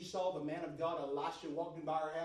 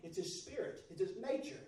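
loud, high, fervent preaching; shouting the sermon up to a climax; full-force preaching; 8 semitones higher, 6 dB louder, faster than usual. Real, not acted. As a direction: preach it out with rising fire.

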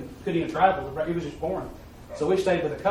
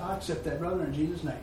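quiet agreement; 6 dB quieter than usual. Retold or imitated. Two brothers quietly agreeing in prayer for rain, soft. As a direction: say it softly, just between two men. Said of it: "not a loud proclamation, nothing".

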